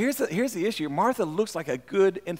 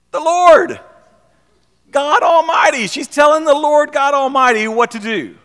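loud, high, outraged preaching; preaching shout; 8 semitones higher, 9 dB louder than usual. Real, not acted. Preacher shouting at the congregation about bossing God around. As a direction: thunder it at the congregation.

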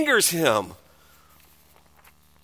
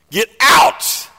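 loud, forceful order to leave; exclaimed command; 2 semitones higher, 6 dB louder than usual. Acted. Preacher acting Jesus clearing the temple, loud angry command. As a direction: throw them out with a loud burst. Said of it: "Exclamation mark".

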